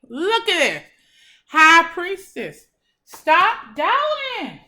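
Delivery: loud, high, excited outburst at the viewer; aggressive excitement. Real, not acted. Tarot reader excitedly urges her viewer, loud and high. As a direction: burst out with real excitement, then stretch stop doubting out slowly. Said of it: "if I'm getting a little aggressive".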